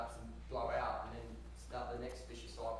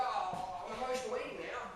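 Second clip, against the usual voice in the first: mock whiny dieter; 8 semitones higher, 4 dB louder than usual. Mocking dieters' excuse.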